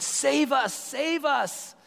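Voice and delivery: high, pleading cry of save us; shouted plea. Retold or imitated. Preacher voicing the crowd begging Jesus, high plea. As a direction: beg him to save you. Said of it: "they shouted".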